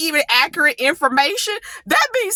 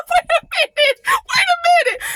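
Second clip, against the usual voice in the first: laughing double take. Real, not acted. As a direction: laugh in disbelief.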